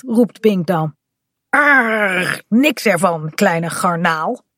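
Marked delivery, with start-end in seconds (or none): 1.5-4.4 pirate growl. Acted. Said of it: "roept Kaat".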